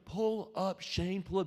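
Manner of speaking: high-pitched mock yell, held back in level. Panicked passenger begging pilot, high mock yell. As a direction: yell it up high without full volume. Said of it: "I won't yell 'cause it'll be too loud".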